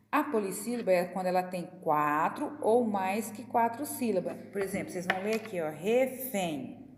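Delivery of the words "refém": "refém" very slow, as re, fém; syllable by syllable. Shown as a demonstration. Teacher having pupils count syllables, "refém" slowly broken into re and fém. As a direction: sound out "refém" in two drawn-out beats. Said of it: "Quantas sílabas tem a palavra refém?".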